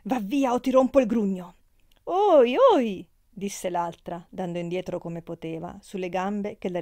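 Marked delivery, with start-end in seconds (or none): 0.0-1.5 loud threat, building in force; angry outburst. A narrator's reading. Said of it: "in uno di quei suoi scatti terribili". 2.0-3.1 high, loud startled cry; alarmed yelp. A narrator's reading. Friend recoils from the threat.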